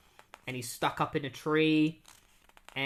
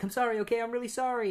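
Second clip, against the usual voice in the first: put-on higher, pleading boy apologizing to his father; frightened apology; 9 semitones higher, faster than usual. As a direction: beg forgiveness, scared of dad. Scared boy apologizes to dad.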